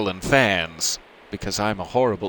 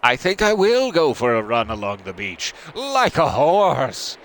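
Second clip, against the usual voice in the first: loud shout, slowing and rising on like a horse; yelling; 5 semitones higher, 5 dB louder than usual. Acted. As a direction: whoop it out, drawing out the horse bit. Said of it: "[name], yelling".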